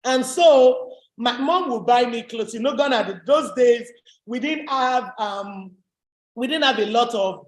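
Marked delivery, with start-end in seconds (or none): none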